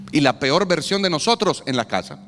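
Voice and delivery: ordinary talk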